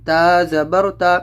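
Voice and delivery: slow spelling drill. Shown as a demonstration. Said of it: "اس کو لمبا بالکل بھی نہیں کرنا ہے".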